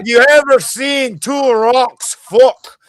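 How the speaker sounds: hammed-up Scottish brogue quoting the panel jab; Scottish accent. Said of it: "I was using a very strong Scottish accent".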